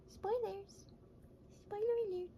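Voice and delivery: high, sing-song mock alarm; playful spoiler tease. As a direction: tease the secret in a bright high voice. Teasing future videos, sing-song.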